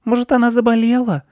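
put-on very high, loud little-girl voice; high-pitched small girl wondering; Anechka. A narrator's reading. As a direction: suggest it in a tiny squeaky child voice. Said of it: "предположила Анечка".

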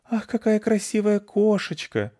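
high, gushing cooing mother; mom cooing over the cat. A narrator's reading. A doting mother coos at the cat from the house.

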